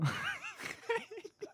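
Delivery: high-pitched wheezing laugh; high squeal of laughter. Real, not acted. Laughing at own joke. (尖声笑)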